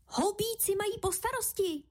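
high, small voice calling out news; high-pitched mouse-child announcement; announcing little mouse. Acted. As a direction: pipe up with news from the doorway. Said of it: "hlásil už ve dveřích".

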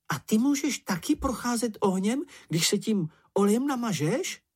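high-pitched little character, full of curiosity; high, eager character voice; Čenich's wondering question. A narrator's reading. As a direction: ask it wide-eyed, voice pitched high. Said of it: "zeptal se Čenich".

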